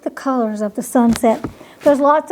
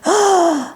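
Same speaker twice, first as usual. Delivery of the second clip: gasp; 5 semitones higher than usual. Acted. Shocked fox sees chalkboard.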